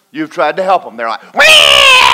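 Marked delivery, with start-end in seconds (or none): 1.3-2.1 sudden high mock wailing cry. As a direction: cry out like a thrashing drowning man. People crying out when helped.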